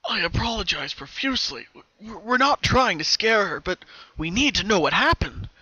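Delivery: calm her down while pressing; a police colt reassuring the mother. A narrator's reading. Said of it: "the colt said reassuringly".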